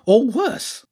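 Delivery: high-pitched, foreboding creature voice; hint at doom in a high voice; ominous hedgehog character. Acted.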